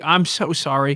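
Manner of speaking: usual speaking voice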